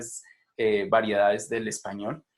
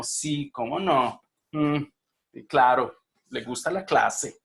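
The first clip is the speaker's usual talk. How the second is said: slow, drawn-out sarcastic agreement; sarcasm; 2 semitones higher, 4 dB louder, slower than usual. Shown as a demonstration. Teacher imitating students' sarcasm about the class, sarcastic. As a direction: agree while clearly not believing it. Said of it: "cuando hacen sarcasmo".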